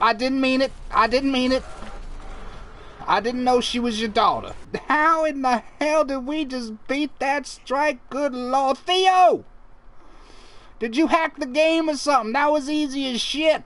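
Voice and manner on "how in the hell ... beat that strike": ordinary talk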